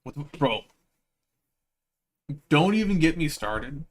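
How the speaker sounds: usual speaking voice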